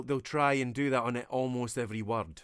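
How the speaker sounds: ordinary delivery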